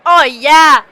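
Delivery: big loud cheer. Real, not acted. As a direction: cheer the roll loudly. Cheering a good roll.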